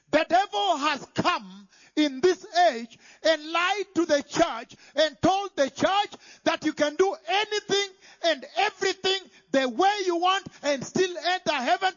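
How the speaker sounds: normal talking voice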